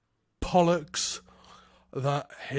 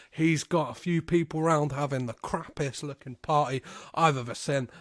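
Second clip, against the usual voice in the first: ordinary talk, nothing marked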